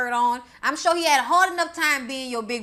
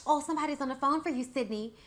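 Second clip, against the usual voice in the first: mock mom; 3 semitones higher, 6 dB quieter, faster than usual. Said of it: "talking about".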